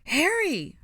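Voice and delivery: high, loud alarmed cry; piercing urgent shout. A narrator's reading. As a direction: scream your friend's name. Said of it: "Hermione cried".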